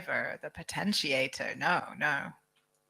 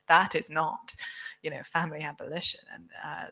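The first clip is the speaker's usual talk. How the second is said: ordinary talk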